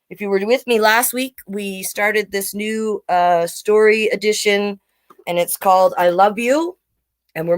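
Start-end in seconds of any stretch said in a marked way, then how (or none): none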